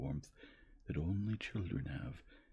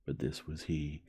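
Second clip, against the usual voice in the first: plain reading voice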